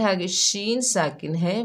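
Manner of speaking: ordinary talk, nothing marked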